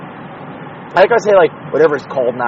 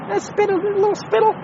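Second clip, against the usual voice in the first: "Undisputable, little spittle" squeaked much higher, playful; silly high voice; 4 dB quieter than usual. Pretend. Host mocking his own word in a squeaky voice. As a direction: chirp "Undisputable" in a high goofy sing-song. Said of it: "silly rhyme voice".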